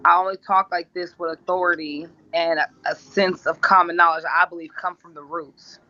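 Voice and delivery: ordinary delivery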